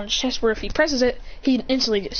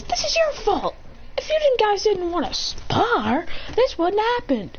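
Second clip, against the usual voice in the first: high-pitched, scolding complaint; acted blame; 11 semitones higher than usual. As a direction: blame your friends in a high voice. Acted quarrel, Ray blames them.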